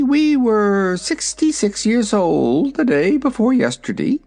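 high, proud little dragon; young dragon voice. Acted. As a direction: talk like a vain baby dragon. Dragonette speaks.

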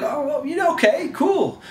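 high-pitched, wavering acceptance; hesitant agreement. Acted. Followers told to preach in Judea, hesitantly agreeing.

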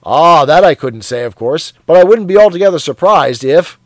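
loud, jovial bloke; a hearty colleague teasing; hearty Parsons. Acted. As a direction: boom it out matey. Parsons voiced in dialogue.

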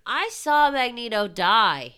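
emphatic cry of disbelief; incredulous protest. Real, not acted. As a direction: insist on it, loudly baffled. Protesting the character's return.

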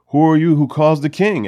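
loud, indignant demand; an indignant shout at the intruder. Retold or imitated. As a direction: challenge him, offended and loud. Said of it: "He's kinda indignant at this point".

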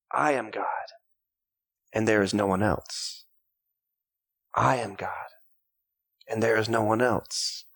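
a hint of a proud, quiet boast; mock arrogant boast. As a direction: boast like you are God. Voicing Babylon's boast, repeated.

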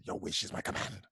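unvoiced whispered genie line.

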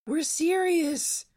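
very high, slow pleading [name]; [name] insisting. A narrator's reading. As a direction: drag it out, begging to be believed. [name] insisting from under covers.

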